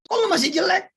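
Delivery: high, loud teasing jeer; teasing a friend about his looks; mock taunt. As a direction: needle him with a shouted tease. Mock insult in dialogue.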